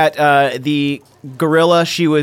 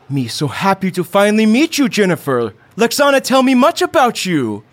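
higher, simple broken English, beaming; caveman dad, delighted; 7 semitones higher than usual. Acted. Ron meets his son's new family, beaming broken-English caveman. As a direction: gush over meeting her. GM voices Ron meeting Jennifer.

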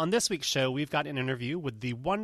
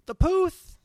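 excited; 13 semitones higher, 9 dB louder than usual. Real, not acted. Hyping a podcast friend.

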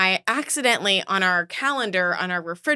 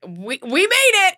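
relieved exit; 10 semitones higher, 5 dB louder than usual. Retold or imitated.

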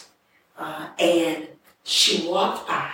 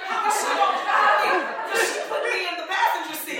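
real laughter through the words, pitched high; high voice breaking up with laughter; laughing; 11 semitones higher than usual. Real, not acted. Teller recalling the drunk driver, laughing as she talks. Laughing while retelling her panicked prayer.

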